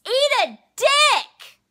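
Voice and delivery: high, loud, drawn-out whoop; exclaiming. Retold or imitated. Quoting Jason as he wins, exclaiming loudly. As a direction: shout it slowly, imitating a triumphant winner. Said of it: "So Jason wins and exclaims".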